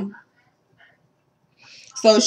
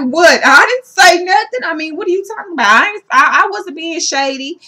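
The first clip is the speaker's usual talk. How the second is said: high, louder mock-innocent protest; indignant denial; 7 semitones higher, 4 dB louder than usual. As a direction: imitate someone startled and defensive at an accusation. Acts out Jennifer's denial to Evelyn.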